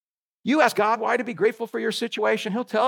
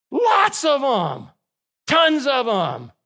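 LOTS and TONS drawn out and hit hard; preacher hammering the point home; slow emphatic punch; 4 semitones higher, slower than usual. Real, not acted.

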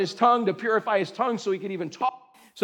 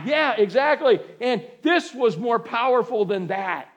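usual speaking voice

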